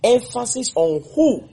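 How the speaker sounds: "who" high and drawn out as a question; probing emphasis. Shown as a demonstration. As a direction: slowly lift WHO to prompt an answer. Teacher prompts class, stressing the question.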